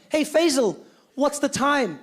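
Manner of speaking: calling out. Acted. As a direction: call over to a mate with a question. Calling out to a man in skit.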